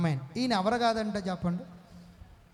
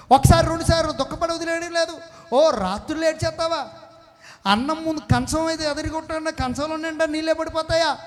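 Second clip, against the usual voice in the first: raised, urgent preaching voice, pressing the crowd; 6 semitones higher, 6 dB louder than usual. Acted. Pastor presses congregation on their nightly tears, preaching loud. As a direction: push each question harder at the congregation.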